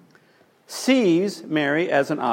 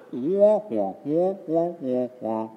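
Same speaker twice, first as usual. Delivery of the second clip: mock baby crying; slower than usual. Mocking husband's whining reply.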